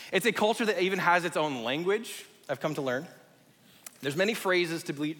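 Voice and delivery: normal talking voice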